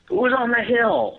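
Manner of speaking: high, slow call into the dark; imitating Matt calling up the hill. Friend on night hunt calls out to someone unseen, high and loud. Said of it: "I hear Matt going".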